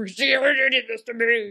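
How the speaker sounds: loud, drawn-out mock wail; mock scream. Dying mother blaming a ten-year-old, mock scream. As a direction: scream the blame out slowly in mockery.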